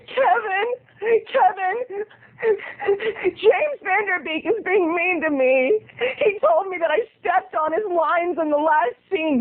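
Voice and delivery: high, whiny mock sobbing; imitating a tearful high-pitched complaint; crying young lady. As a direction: whimper his name like a wronged starlet. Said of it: "she was crying, and she was going".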